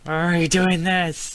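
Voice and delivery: loud, high, drawn-out question; exasperated outcry. Real, not acted. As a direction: cry it out in exasperation. Repeats the question at the game.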